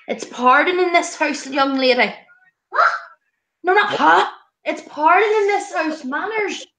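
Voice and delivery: mock telling-off of the dog; mock stern scolding. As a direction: play the strict mum, half joking.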